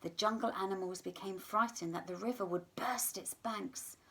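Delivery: usual speaking voice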